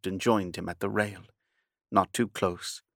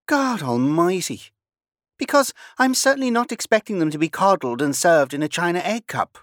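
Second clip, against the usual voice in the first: much higher and louder, biting sarcasm; indignant outburst; 9 semitones higher, 7 dB louder than usual. Acted. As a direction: hurl it back at him, affronted.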